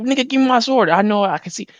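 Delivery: high, loud mock Zoro yelling for his sword; loud demand for the sword. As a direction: shout it like you want that sword back now.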